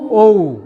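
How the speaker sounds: loud, long ও gliding into "oo"; English O. Shown as a demonstration. Teacher shows the new way, loud gliding ও. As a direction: stretch ও and round it off to "oh-oo". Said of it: "but এখন থেকে কি বলবো?".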